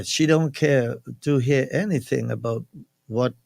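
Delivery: normal talking voice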